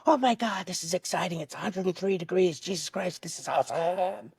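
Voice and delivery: high, breathy-sounding thrill in a voice unlike his own; thrilled project manager. Retold or imitated. Project manager marveling at the trench flight, high excited gush. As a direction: gush like a man who just got his day made. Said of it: "you hear his tone".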